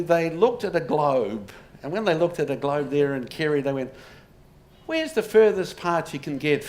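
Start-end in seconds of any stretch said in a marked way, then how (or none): 4.9-6.6 lightly put-on Irish accent, a little louder; a half-hearted Irish brogue for the family legend; bad Irish accent. Lecturer voicing Kerry rebels in a mild Irish accent. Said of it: "That's a terrible Irish accent".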